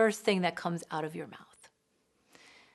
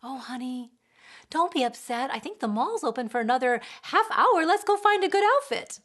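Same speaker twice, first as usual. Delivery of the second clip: high, coddling parent, rising; rescuing; 5 semitones higher, 6 dB louder than usual. Retold or imitated. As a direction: soothe and rush to fix it. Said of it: "we might rescue".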